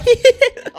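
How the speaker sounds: loud, high cackle; laughing loudly at the stare; high burst of laughter. Real, not acted. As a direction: let out a shrill helpless laugh. Burst after staring.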